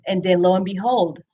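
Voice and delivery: usual speaking voice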